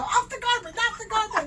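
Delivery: high, loud urgent command; mock alarmed yell; retold shout at dog. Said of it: "I'm trying to drive her".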